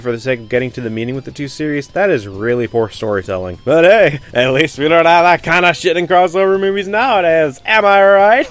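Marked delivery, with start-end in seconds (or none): 3.6-8.5 loud, higher mock cheer; louder, higher sarcastic rhetorical question; sarcastic jab. Real, not acted. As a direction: sell the joke like a comedy punchline. Jab at modern crossover movies.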